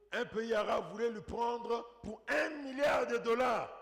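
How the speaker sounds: high, loud preacher voice; impassioned preaching. Real, not acted. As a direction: build to a loud, amazed peak on the huge sums. Preacher builds at full force.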